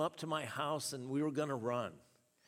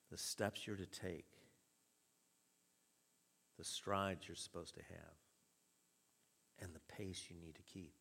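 softer appeal; 8 semitones lower, 9 dB quieter than usual. Real, not acted. Preacher softening into intimate appeal.

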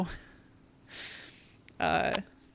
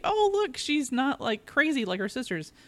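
mock delighted realisation, starting very high and settling; mock exclamation; 5 semitones higher, 4 dB quieter than usual. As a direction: quote yourself in a high, gushing aside. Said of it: "because I'm like".

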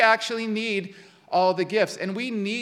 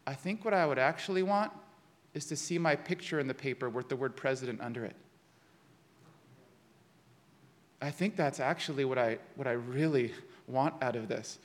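softer, lower, small and honest admission; 6 semitones lower, 8 dB quieter than usual. Retold or imitated. Parker admits the real reason to the circle, small honest voice. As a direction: confess it quietly, plainly honest. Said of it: "in a small but honest voice".

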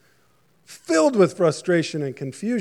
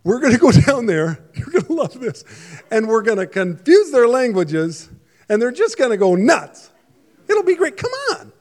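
higher, louder, gleeful prankster voice with a laugh; mischievous God; 5 semitones higher, 4 dB louder than usual. Pretend. A skeptic's picture of God calling the Son and Spirit over, gleeful and mischievous. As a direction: call the others over like a kid planning a prank. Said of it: "if you were a skeptic".

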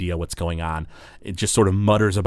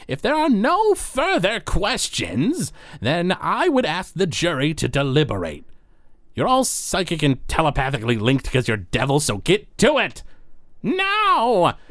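commanding judge; 9 semitones higher than usual. Acted. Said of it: "the judge smacks the gavel down".

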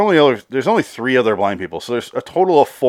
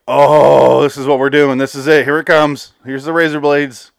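higher, louder, building thrill; excited anticipation; 5 semitones higher, 7 dB louder than usual. Retold or imitated. Viewer awaiting the razor blade scene, excited anticipation. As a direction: gush with fan's anticipation. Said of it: "I was so excited".

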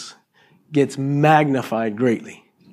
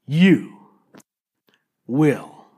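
ordinary talk

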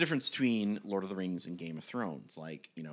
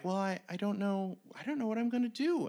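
higher, quicker goblin voice, lost and hesitant; unsure goblin; 9 semitones higher than usual. Retold or imitated. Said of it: "the goblin was like".

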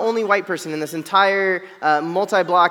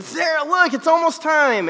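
much higher, bursting with excitement; excited call; 9 semitones higher than usual. Retold or imitated. Husband calls wife Sarah to the smoker, thrilled and high-pitched. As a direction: call your wife over like a kid at Christmas. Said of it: "and I'm so excited".

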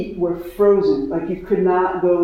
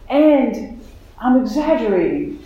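surprising inner voice; 5 semitones higher than usual. Retold or imitated. A new voice cuts in while she cries, lightly voiced. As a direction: let an unexpected voice pop out, lightly voiced. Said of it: "this voice that said".

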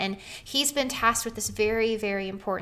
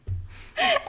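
laugh. Real, not acted. Laughing at the uncanny coincidence.

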